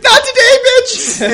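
shrieked very high and loud; defiant scream. Acted. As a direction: scream it full out as the heroine fighting back. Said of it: "She screams".

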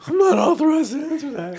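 high squeaky cartoon voice. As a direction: pitch it up like a cartoon. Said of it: "going through his Simpsons characters".

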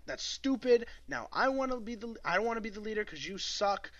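a petulant lizard throwing a tantrum, imitated; mock angry outburst. Retelling the young Silurian angrily demanding leadership, mock angry. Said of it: "the young Silurian gets pissed".

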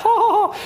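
high-pitched mock "ha, ha, ha"; imitated laughter. Preacher retelling a film's restaurant scene, mock laughter. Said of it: "and so they laugh".

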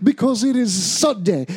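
slow, high evangelist calling out; preacher voice. Acted. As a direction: preach it like a revival pastor. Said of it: "[name] has taken over a mega church".

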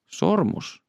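SORMUS pitched high, then falling; high, questioning repeat of SORMUS; questioning echo. A narrator's reading. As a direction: pick up on SORMUS with sudden, high-pitched interest. Detective queries the item just named.

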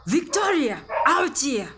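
high, sharp summons; sharp fatherly call. Acted. Father summoning the daughter, sharp high call. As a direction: call out sternly as the angry father. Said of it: "the sharpness of your voice".

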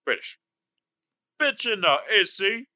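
attempted Cockney, dropped h; British accent. Shown as a demonstration. Contestant settling on British as the answer, Cockney British accent. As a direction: say it again in a put-on British accent. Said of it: "the best British accent".

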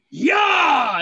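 loud triumphant yell; shout. Acted. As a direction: holler it out. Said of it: "you hear him go".

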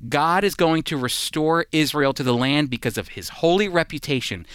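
ordinary talk

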